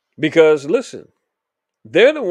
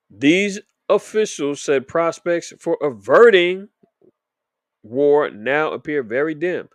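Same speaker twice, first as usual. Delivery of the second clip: slowed right down, each word leaned on and louder; slow and emphatic; 5 dB louder, slower than usual. Shown as a demonstration. Reading the headline again for slow viewers, slow and loud. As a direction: spell it out for the slow people in the back. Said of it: "for the slow people in the back".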